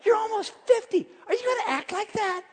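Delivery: high mocking scold at himself; scolding self. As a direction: chide yourself like a fed-up parent. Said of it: "I tell myself".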